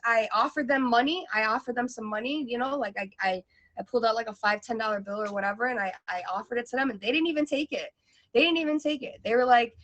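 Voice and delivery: ordinary talk, nothing marked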